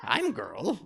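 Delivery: goofy put-on troll voice; mock troll introducing herself. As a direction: play a clumsy comic troll. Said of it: "that's a troll voice".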